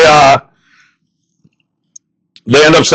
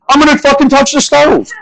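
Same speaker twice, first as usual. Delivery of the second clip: defiant; 9 semitones higher than usual. Real, not acted. Defiant outburst.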